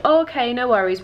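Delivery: no-problem salesman patter; breezy reassurance. Retold or imitated. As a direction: imitate a seller brushing it off. Acting the barterer's easy reply.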